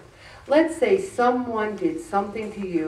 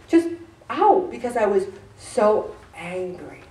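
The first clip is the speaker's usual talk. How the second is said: usual speaking voice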